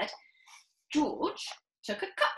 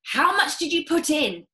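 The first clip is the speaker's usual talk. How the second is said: high, loud and keen; father quizzing George, loud; eager father; 7 semitones higher, 8 dB louder than usual. Acted. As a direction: press for the amount. Mr Cranky eager.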